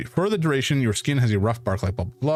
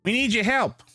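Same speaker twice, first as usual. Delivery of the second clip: squeaky ant voice; 9 semitones higher than usual. Acted. Ant character speaks.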